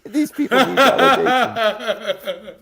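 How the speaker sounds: high-pitched, slow words through laughter; laughing hard. Real, not acted. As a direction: barely get it out for laughing. Cracking up while talking.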